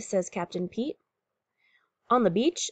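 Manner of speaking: ordinary delivery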